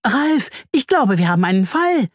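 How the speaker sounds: buzzing with excitement, pitched high; excited. A narrator's reading. Bertha the bee brings Ralf a new case, excited. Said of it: "summt sie aufgeregt".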